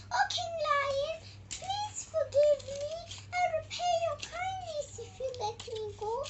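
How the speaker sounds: high, fast, tearful mouse plea; pleading mouse. Acted. Mouse begging the lion to let her go, high tearful plea. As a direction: beg the lion in a tiny squeak.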